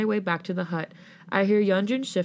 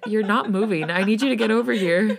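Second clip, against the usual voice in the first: loud, higher-pitched complaint with laughter creeping in; complaining; 3 semitones higher, 6 dB louder than usual. A narrator's reading. Friend needs player over here, complaining loudly. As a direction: nag louder, pitched up. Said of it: "Maddie complains through her headset".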